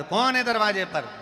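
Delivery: high, long-drawn demand to the door; calling from inside. Acted. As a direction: holler to whoever is outside. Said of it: "अंदर से आवाज लगाकर".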